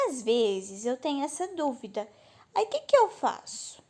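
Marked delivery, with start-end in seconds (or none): none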